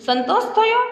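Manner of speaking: "સંતોષ થયો" in a high questioning tone; pointed, high-pitched question on "સંતોષ થયો". A narrator's reading. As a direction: ask "સંતોષ થયો" with a big lift in pitch. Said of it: "તેને આમ ક્વેશ્ચનથી પૂછ્યું".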